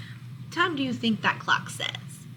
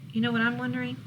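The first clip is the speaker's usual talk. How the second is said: plain reading voice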